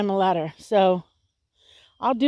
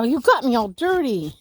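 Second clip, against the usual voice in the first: ordinary talk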